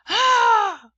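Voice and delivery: loud, high "ah" of fright; acted startled shriek; frightened gasp. A narrator's reading. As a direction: gasp loudly as if spooked. Boy re-enacts his fright.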